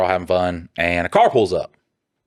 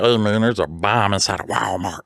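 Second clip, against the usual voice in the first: lightly put-on caller voice; change up your voice a little; a mildly altered prank voice; different voice. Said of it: "I coulda been like".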